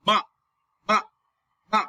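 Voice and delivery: "ma" short and dropped sharply; quick falling "ma"; falling fourth tone. Shown as a demonstration. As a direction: start ma high and drop it fast. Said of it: "Fallen tone is fourth tone".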